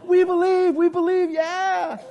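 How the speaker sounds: high, loud mock chant of the crowd; eager crowd. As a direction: call it out like a thrilled crowd. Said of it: "like this".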